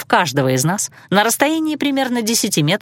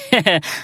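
mocking chuckle; 2 semitones lower than usual. A narrator's reading. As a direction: chuckle briefly at your own jibe. Magister laughs at his jibe.

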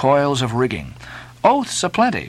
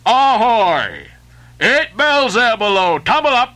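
bell-calling sailor; 6 semitones higher than usual. Acted. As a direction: call the sleepers up on deck. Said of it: "Second Nantucket sailor".

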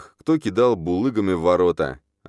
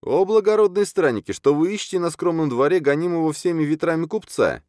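very high, anxious wheedling; frightened merchant; 11 semitones higher than usual. A narrator's reading. Merchant peering out at a mob, frightened. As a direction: plead in a high, timid voice. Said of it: "выглянул перепуганный Абусимбел".

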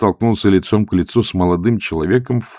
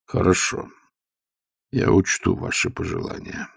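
coldly; 4 dB quieter than usual. A narrator's reading. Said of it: "холодно сказал старик".

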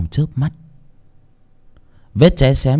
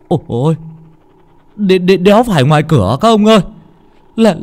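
much higher, stammering in panic; terrified cry; 9 semitones higher, 3 dB louder than usual. Acted. A mourner tells the others the knocking is inside the coffin, stammering in terror. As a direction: stutter it out, too scared to finish. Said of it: "Một người trong đám kêu lên".

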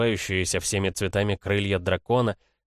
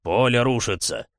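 raised, higher alarmed elephant call; rumbling elephant; 4 semitones higher, 4 dB louder than usual. A narrator's reading. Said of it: "пророкотал слон". (раскатистый тревожный возглас слона)

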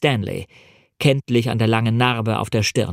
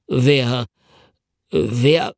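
stammering. A narrator's reading. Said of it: "stotterte jemand".

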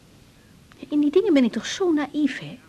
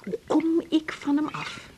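ordinary talk, nothing marked

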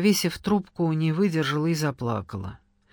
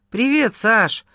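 a touch sheepish, louder greeting; embarrassed; 4 semitones higher, 5 dB louder, slower than usual. A narrator's reading. Wife greeting her husband on the phone, mildly embarrassed. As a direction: greet your husband a bit awkwardly, caught out. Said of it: "смущённо отозвалась Кира".

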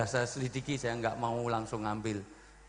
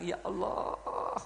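long, drawn-out high lament; imitated tearful cry; 7 semitones higher, much slower than usual. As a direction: stretch the call to God into a mock wail. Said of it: "menangis sejadi-jadinya".

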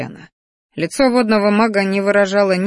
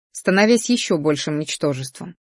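ordinary delivery, nothing marked

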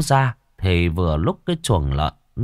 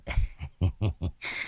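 put-on soft wheezing old man's laugh; quiet cackling old man; wheezy cackle; 3 semitones lower, 10 dB quieter than usual. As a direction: wheeze out a sly chuckle. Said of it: "khè khà".

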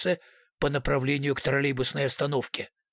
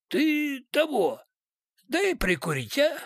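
higher, slow husky drawl; 12 semitones higher, slower than usual. A narrator's reading. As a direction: rasp it like a street thug. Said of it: "сипло сказал".

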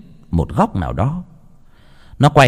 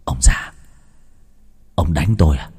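put-on drunken whisper; 7 semitones lower, 3 dB quieter than usual. A narrator's reading. Drunk son taunts father.

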